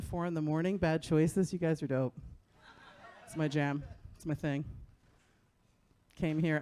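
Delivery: ordinary talk, nothing marked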